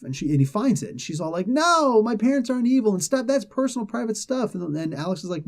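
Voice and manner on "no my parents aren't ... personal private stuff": put-on higher, indignant teen girl voice; mock protest. Carolina snapping at friends, high indignant voice. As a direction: play the girl brushing them off, offended.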